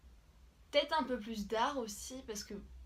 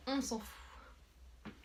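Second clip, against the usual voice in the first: whispered aside; 2 semitones higher, 5 dB quieter, much slower than usual. Real, not acted. Dismissive aside.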